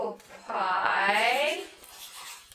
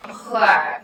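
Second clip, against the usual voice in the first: normal talking voice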